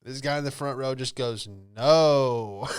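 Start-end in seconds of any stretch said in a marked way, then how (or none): 1.5-2.7 loud, deadpan heckler; flat loud heckler no. Retold or imitated. Front-row heckler shooting down the magician, blunt and loud.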